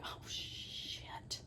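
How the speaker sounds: breathy hushed curse; despairing whisper. Retold or imitated. Realizing the hard day ahead, whispered. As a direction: sigh it out under your breath.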